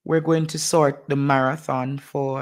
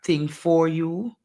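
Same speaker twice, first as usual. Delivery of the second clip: ordinary talk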